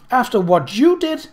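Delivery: high, loud and indignant; voice rising. A narrator's reading. Bernice, furious at her sister, voice rising high and loud. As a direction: let the voice climb high in outrage. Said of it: "Her voice rose".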